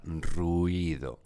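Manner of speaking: "ruido" stretched into ru-I-do; hiatus. Shown as a demonstration. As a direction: say "ruido" in three syllables, ru, i, do. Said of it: "hay que pronunciarla en tres sílabas".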